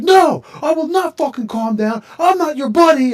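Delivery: retold rage at a pushy stranger, yelled high and loud; angry quote. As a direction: shout it back like a man who won't be calmed. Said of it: "And he was like".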